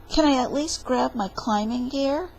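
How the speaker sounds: ordinary delivery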